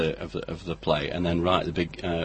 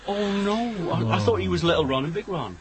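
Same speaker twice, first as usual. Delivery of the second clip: mock surprise; 11 semitones higher than usual. Mock confusion over Ronnie Barker.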